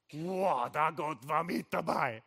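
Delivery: loud, slow cry of wonder; amazed, as if God just showed up; awed wow. Retold or imitated. Awe at God's presence, preacher.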